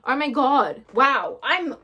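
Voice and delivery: ordinary delivery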